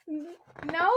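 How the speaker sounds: ordinary delivery, nothing marked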